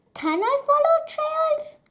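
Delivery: high, bright excited question; excited wonder. Acted. Alfie reads the job ad, excited. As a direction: squeak it with rising hope.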